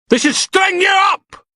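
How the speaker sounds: high, slow, furious shouting; an enraged protester yelling at the prisoner; furious insults. Acted. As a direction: scream abuse at him.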